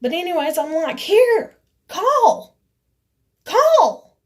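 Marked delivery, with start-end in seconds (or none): 1.0-4.2 high, loud barked commands; shouted challenge. Retold or imitated. Handing her the phone to call the cops, shouting. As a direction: re-enact yelling a dare, each word separate.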